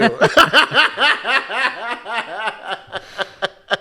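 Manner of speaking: long, high burst of laughter; laughing. Real, not acted. Cracking up at the redubbed meme impression, long laugh.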